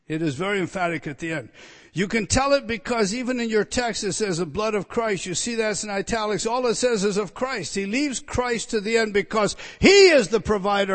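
loud, driving pulpit delivery, pressing each point home; preaching at full force. Acted. Preacher to his congregation about redemption, preaching at full force. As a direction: thunder it out to the congregation.

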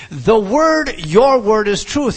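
loud, high punch on WORD, then on YOUR; equal stress on word and your. Shown as a demonstration. Preacher showing Christ's emphasis, equal stress on word and your. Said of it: "He is emphasizing the word".

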